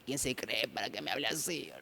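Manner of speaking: hushed, resentful grumble; indignado por dentro. Acted. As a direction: play offended, grumbling under your breath. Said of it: "uno está que ahí como que". (refunfuño ofendido en voz baja)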